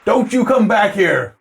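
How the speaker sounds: high-pitched distant-sounding yell; angry warning shout. Acted. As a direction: yell a threat after a fleeing intruder. Man yells after the fleeing intruder.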